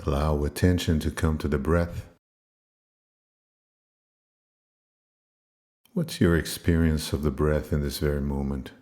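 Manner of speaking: low, unhurried meditation voice with long pauses; guided meditation. Acted. Teacher leading listeners into controlled breathing, guided meditation voice. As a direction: calmly guide them into the breath, pitched low.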